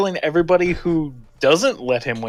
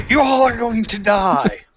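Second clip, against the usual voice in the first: mock menacing; 6 semitones higher, 4 dB louder than usual. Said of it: "he's like".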